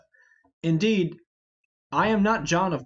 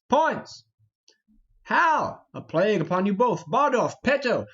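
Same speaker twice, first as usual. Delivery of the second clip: shouting names after vanished friends; loud, higher calls into the dark; calling out; 4 semitones higher, 4 dB louder than usual. Acted. Falstaff shouting for his missing companions, loud calls.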